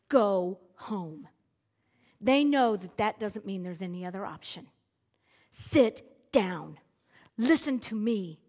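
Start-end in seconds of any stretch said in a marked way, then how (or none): 0.1-1.3 "Go home." as a firm order, stress on "Go"; a parent's no-discussion "Go home.". Shown as a demonstration. Parent orders the kids home, firm parental command. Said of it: "As a parent, I can look at my kids and say". 5.5-8.4 louder, firm parental command; parent's order. Shown as a demonstration. Parent orders the kids to sit, loud firm command. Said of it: "It was that kind of a word".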